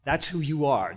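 ordinary delivery

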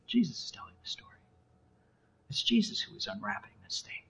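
hushed whisper, very soft; barely voiced emphasis; whispered reveal. Real, not acted. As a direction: whisper it like a solemn revelation. Hushed reveal of who tells it.

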